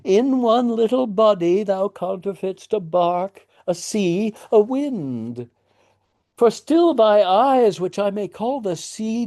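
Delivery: put-on high cooing father soothing a weeping child; doting parable. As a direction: spin the metaphor like a nursery tale. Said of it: "he makes a little parable".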